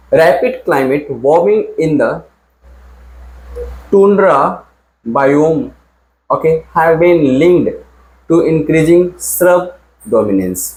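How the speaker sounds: reading the question slowly, word by word; teacher reading an exam sentence aloud; slow dictation reading. Shown as a demonstration. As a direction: read "Rapid climate warming in the tundra biome" very slowly. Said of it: "अब बताओ क्या होगा answer".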